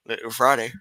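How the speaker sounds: ordinary talk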